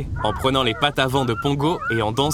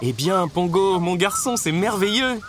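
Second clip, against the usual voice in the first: high, beaming praise for the dog; delighted Roger; 7 semitones higher, slower than usual. A narrator's reading. Roger congratulating Pongo on the puppies, delighted. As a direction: marvel aloud to Pongo.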